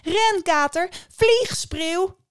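high, loud, stretched calls; screaming for help. A narrator's reading. Dragged-off boy calls his brothers, screaming for help. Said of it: "De jongen begon te schreeuwen".

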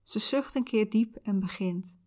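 calm soothing visualization guide. Acted.

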